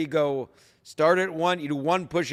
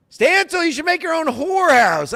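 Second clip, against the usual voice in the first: quoted fan voice; 12 semitones higher, 7 dB louder than usual. Retold or imitated. Quoting a fan's suggestion.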